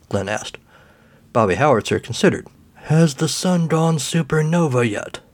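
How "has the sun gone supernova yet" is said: put-on slow, frail old man voice. A narrator's reading. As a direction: speak like an ancient, forgetful resident. Old man character speaks.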